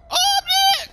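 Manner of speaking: "Oh, I'm dead!" in a high squeal; hammed-up falsetto cry of defeat; squeaky high mock wail. Gamer squeals at his own in-game death. Repeated line, pitched way up.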